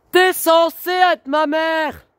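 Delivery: high, loud and slow, each word pushed; screamed reproach. Real, not acted. Daughter screams at her mother, hurt and furious. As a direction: scream the accusation.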